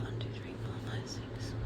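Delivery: barely audible whispered count; hushed, private counting aside. Real, not acted. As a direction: count to six under your breath. Counting to self mid-report.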